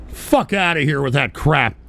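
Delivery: normal talking voice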